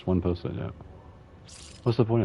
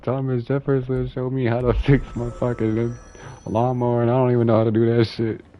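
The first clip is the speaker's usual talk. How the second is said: loud quote through laughter, getting louder; laughing retold quote; 8 semitones higher, 10 dB louder than usual. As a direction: shout it out while cracking up. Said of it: "He said".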